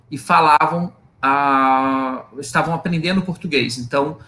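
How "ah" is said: normal talking voice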